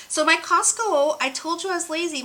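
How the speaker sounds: eager giddy outburst; excited. Real, not acted. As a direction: blurt it out, thrilled. Excited about Costco bargain.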